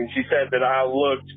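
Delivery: plain reading voice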